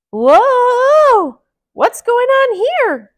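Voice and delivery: high, loud squeaky mouse cry; Mickey crying out. Acted. Mickey startled by the shaking rock, high cry. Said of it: "cried Mickey".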